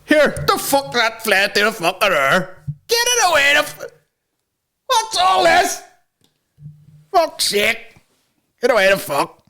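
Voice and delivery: high, put-on dialect voice; imagined astonished pilot. Imagining a pilot seeing a UFO, hammed-up astonishment. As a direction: exclaim in a high, hammed-up regional voice.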